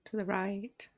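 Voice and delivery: soft, unhurried meditation voice with long pauses; guided meditation. Acted. Meditation teacher guiding listeners to settle the body, softly and calmly. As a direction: ease them gently into stillness, leaving space between phrases.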